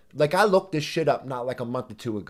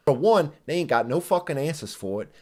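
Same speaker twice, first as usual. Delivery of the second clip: ordinary talk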